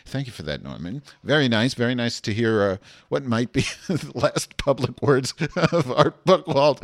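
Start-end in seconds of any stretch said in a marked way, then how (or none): 3.1-6.8 laughs through the words, getting louder; laughing. Real, not acted. Host laughing at the awkward call, laughing and louder. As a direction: crack up while saying it.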